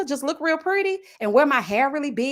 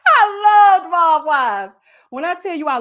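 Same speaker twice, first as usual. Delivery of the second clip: mock sobbing; 7 semitones higher, 7 dB louder than usual. Mock weeping over the show.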